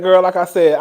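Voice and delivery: ordinary talk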